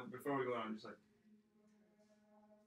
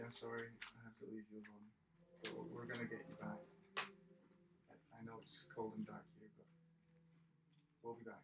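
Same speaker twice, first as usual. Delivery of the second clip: hushed apology; 2 semitones lower, 12 dB quieter than usual. Acted. Player leaving someone behind in the cold, hushed apology. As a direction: apologise quietly and tenderly, trailing softer.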